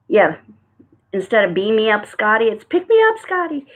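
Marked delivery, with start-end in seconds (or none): 2.6-3.7 pitched-up playful punchline; playful catchphrase. Acted. Host swaps Beam me up for a card pun, playful catchphrase. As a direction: pop it up high as a joke.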